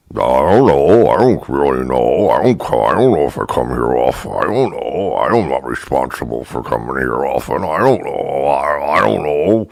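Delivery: low, dopey bartender playing dumb; stupid voice. Bartender to complaining customer, dopey low voice. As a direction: mumble like you know nothing. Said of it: "talking in that stupid voice".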